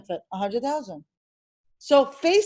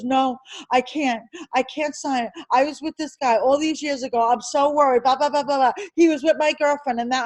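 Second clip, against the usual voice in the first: normal talking voice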